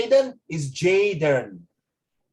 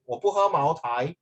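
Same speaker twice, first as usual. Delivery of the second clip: usual speaking voice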